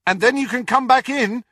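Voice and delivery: high, loud call up the steps; shouted after her. A narrator's reading. Harry calling after Ginny as she runs off, shouting. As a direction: call after someone running away. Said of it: "he shouted after her".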